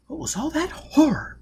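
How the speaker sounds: high, shaken whisper; horrified whisper. Acted. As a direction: breathe it out in horror. Robin shaken after the attack.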